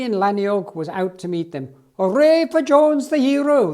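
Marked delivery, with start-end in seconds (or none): 2.0-3.7 much higher, louder cheer; crowd shouting. Acted. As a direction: cheer the hero home. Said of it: "they shouted".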